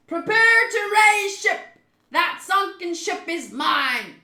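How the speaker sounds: loud, slow, high declaration; drawn-out commanding shout; pirate boss's bellow. Acted. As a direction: bellow the order to the crew. Pirate boss bellowing orders.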